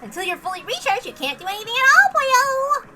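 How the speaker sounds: high, squeaky little AI chirping alerts; cute mascot voice. Acted. Suit AI telling Meow he's out of energy, high and chirpy. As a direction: chirp it like a tiny mascot gadget.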